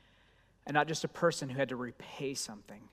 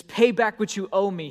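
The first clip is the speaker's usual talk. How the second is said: loud, higher demand for payment; 5 semitones higher, 9 dB louder than usual. Acted. Servant choking his fellow servant, loudly demanding payment. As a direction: lean into him and demand the money. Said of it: "he demanded".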